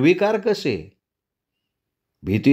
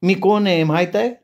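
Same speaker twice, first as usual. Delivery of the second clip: much higher, rising on the first word; eerie village woman; 6 semitones higher than usual. Acted. The woman answers the traveller, high and strangely calm. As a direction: speak with an odd calm.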